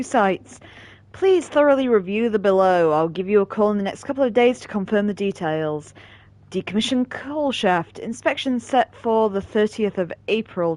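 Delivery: loud formal email read. Acted. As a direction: read it like an official notice. Reading game email aloud.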